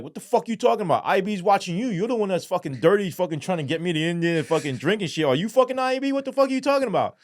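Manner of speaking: loud, high, indignant bluff; high angry accusation that speeds up at the end; mock outrage. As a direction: turn it around on him, faking fury. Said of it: "trying to play it off".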